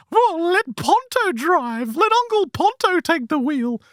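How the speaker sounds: very high, sing-song eunuch voice. Acted. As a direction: squeeze it up into a strange, high, sing-song creature voice. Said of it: "the weird creature's like".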